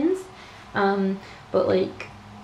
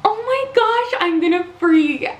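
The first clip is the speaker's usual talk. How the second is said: high, loud squeal of delight; excited squeal; 7 semitones higher, 5 dB louder than usual. Real, not acted. Collector squealing at her dream pin, high and loud. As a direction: squeal over the pin you wanted most.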